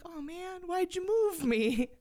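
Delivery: a light high whine for the tree; whiny tree voice. Pretend. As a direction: play the tree, put out. Voicing the tree complaining.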